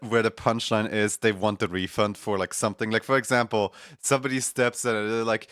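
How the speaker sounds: ordinary talk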